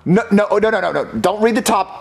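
ordinary delivery

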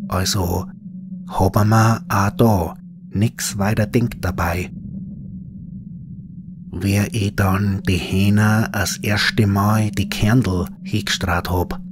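plain reading voice

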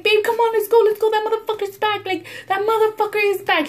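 put-on frantic high plea to boyfriend to drive off; panicked urging. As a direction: hurry your man like the fly is chasing. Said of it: "I'm like".

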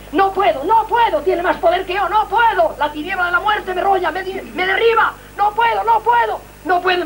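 losing the fight, crying out; overpowered panic. Retold or imitated. Retold death overpowering him.

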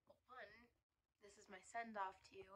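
ordinary talk

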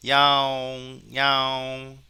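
loud, long-held meow on a level pitch; cat meow imitation. Shown as a demonstration. Teacher recording cat sounds for training, imitated meowing. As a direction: stretch out each meow like a cat. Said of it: "고양이 사운드를 한번 우리가 저장해 보면".

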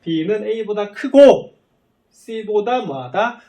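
loud, high, very slow reading with 크고 held out; deliberate read-out. Shown as a demonstration. Teacher recalling for students how it was read, deliberate read-out. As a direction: punch and stretch 크고, pause, then ask 뭐하다 slowly. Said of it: "읽을 때 뭐라고 읽었냐면".

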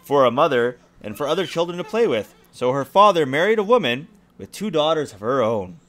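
lively storybook reading; story narration. A narrator's reading. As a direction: read it like a bedtime story. Reads fairy tale to child.

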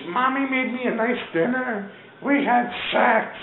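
high, hammed-up plaintive voice; mock lament. Arrested Charlie recalls his evening, high mock lament. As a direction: imitate him wailing out his story.

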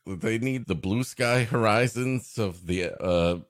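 ordinary talk

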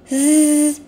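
imitated zipper buzz; zip sound. The zookeeper opening his bag, zipper buzz. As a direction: zip it open with a zzz.

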